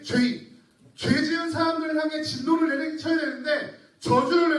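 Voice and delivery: loud, high, driving sermon build; fervent preaching. Acted. Pastor to congregation on God's wrath, fervent preaching. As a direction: pour it out like a pulpit climax. Preacher rises to full force.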